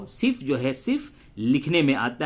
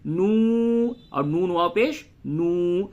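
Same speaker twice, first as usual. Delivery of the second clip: stretched vowel; 2 semitones higher than usual. Shown as a demonstration. Teacher shows both spellings are lengthened, stretched نو. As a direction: hold the u of نو. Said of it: "ان دونوں کو کھینچ کر کے پڑھیں گے".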